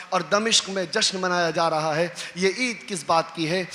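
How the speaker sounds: low, soft narration; hushed storytelling. A narrator's reading. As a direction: draw them in quietly. Hushed retelling of Sahl's arrival.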